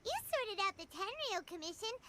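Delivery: usual speaking voice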